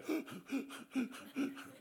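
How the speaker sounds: mock panting, puffed from running; heavy panting breaths, acted out. Someone running to heaven, panting, all out of breath. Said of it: "running to heaven all out of breath".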